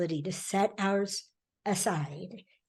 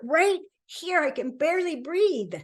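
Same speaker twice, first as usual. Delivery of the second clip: much higher, hammed-up breathless hurt; higher-pitched mock complaint to a baffled partner; mock wounded woman; 7 semitones higher than usual. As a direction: play the wounded one, hand on chest, overdone.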